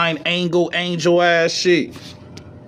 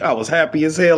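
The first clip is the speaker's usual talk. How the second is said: normal talking voice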